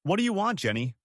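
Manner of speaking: ordinary delivery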